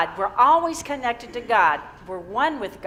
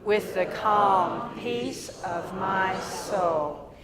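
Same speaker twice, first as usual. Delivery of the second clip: slow affirmation; much slower than usual. Real, not acted. Minister leading the congregation in an affirmation, slow and measured.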